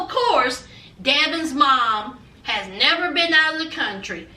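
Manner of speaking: usual speaking voice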